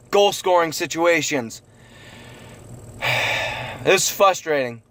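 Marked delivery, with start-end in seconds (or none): 3.0-3.8 breathy sigh. Real, not acted. Frustrated fan venting.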